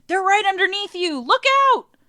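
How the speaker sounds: loud, high-pitched crew shout; acted alarm, rising to a big high holler; yelled warning. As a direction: yell an urgent warning up from the boat. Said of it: "he hears the crew up on the boat yelling".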